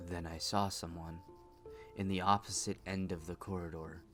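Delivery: soft, low, brooding patient; quiet troubled woman. Acted. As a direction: tell it like a haunted confession. Patient continues eerie story.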